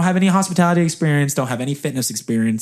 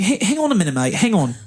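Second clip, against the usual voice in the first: put-on higher-pitched, amateur New Zealand accent; bad New Zealand accent; 6 semitones higher than usual. Interviewer interrupts an applicant, bad New Zealand accent. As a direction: put on a shaky-quality Kiwi accent. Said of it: "do a very bad New Zealand accent".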